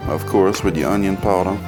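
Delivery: ordinary talk, nothing marked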